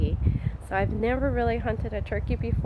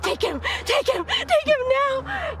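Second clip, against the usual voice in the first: urgent hunting whisper. Retold or imitated. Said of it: "You're like".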